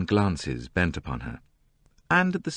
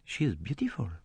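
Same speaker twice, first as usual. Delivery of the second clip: murmured; 5 semitones higher, 9 dB quieter than usual. A narrator's reading. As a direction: say it under the breath. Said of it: "He murmured".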